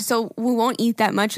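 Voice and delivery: ordinary talk, nothing marked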